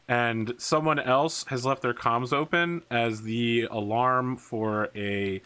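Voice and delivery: ordinary delivery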